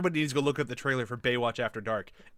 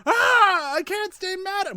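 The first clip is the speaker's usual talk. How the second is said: high, loud drawn-out mock cry; mock wailing quote; 6 dB louder, slower than usual. Woman left on a crashing plane, hammed-up high wail. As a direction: shriek it in a very high voice, hamming it up. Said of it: "and she's like".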